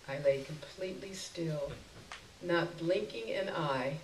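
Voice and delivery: ordinary delivery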